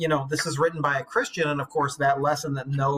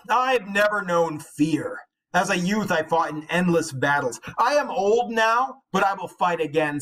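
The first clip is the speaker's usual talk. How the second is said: put-on old hero's boast; 3 semitones higher, slower than usual. Beowulf boasting to his followers before the dragon, proud and slow. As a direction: boast like an aging warrior. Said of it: "Beowulf uttered his final boast".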